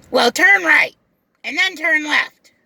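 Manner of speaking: high, loud shouted orders; the passenger shouting turns at the driver; bossy shouted directions. Retold or imitated. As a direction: yell the directions as the bossy customer. Customer barks directions.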